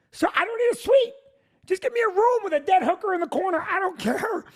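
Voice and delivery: high, fast exasperated rant; desperate pleading. Retold or imitated. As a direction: rant it like a man at his limit. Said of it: "And I'm like".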